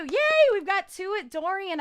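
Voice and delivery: high, loud squeal of joy; joyful cheer. Real, not acted. Thanking Joelle for the gigs, a high loud joyful cheer. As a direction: cheer it out with delight. Celebrating second Dorian gig.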